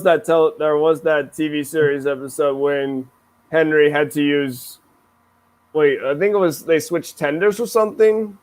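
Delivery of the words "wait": ordinary talk, nothing marked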